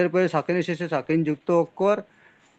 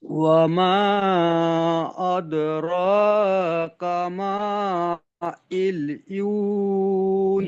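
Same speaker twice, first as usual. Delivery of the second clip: Quran recitation; much slower than usual. Real, not acted. Student recites the verse.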